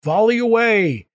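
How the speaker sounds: high, loud warning cry; shouting from crow's nest. Acted. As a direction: yell the warning from aloft. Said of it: "the lookout cried out a warning".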